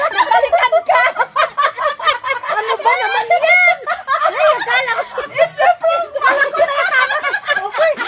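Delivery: loud, high, laughing yell; laughing shout. Real, not acted. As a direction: shout through your laughter. Splashed by prank, laughing.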